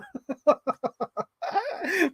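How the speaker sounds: high squeaky fan giggle; giddy giggle. Retold or imitated. Fan laughing at her own gush, squeaky high giggle. Giggle after fangirl quote.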